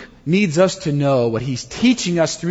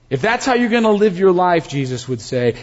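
normal talking voice

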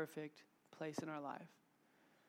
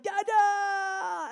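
put-on high, drawn-out shout of a little boy; excited child's shout; 15 dB louder than usual. Son running to his dad, excited high shout. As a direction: call out loudly like a toddler running to Dad.

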